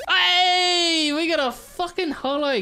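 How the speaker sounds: high laughing yelp of delight; excited yelp. Real, not acted. Pulls a holo Gyarados on camera, laughing excited yelp. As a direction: squeal at the rare pull.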